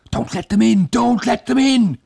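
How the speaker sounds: high, loud panicked cry; panicked scream. A narrator's reading. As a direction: shriek in terror.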